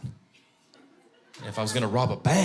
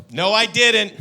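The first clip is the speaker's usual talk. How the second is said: louder, higher flat denial; dad denying; 9 semitones higher, 9 dB louder, slower than usual. Retold or imitated. Dad looking back at daughter, loud denial. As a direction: deny it firmly. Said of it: "I go".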